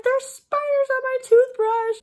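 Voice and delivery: high, loud, slow panic; panicked. Retold or imitated. Telling mom about the spiders, high loud panic. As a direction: wail it out in terror. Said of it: "I was like".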